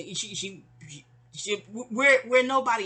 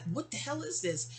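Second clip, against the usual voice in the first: mildly stunned disbelief, higher and hushed; softer, higher mock bewilderment; dumbfounded; 5 semitones higher, 6 dB quieter than usual. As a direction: retell a dumbfounded reaction under your breath. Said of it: "we were definitely dumbfounded".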